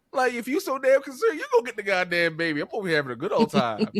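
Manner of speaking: high, animated comeback; quick, high-pitched teasing protest; mock exasperated retort. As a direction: fire back at a worried partner, playfully. Acts out retort to worried mother.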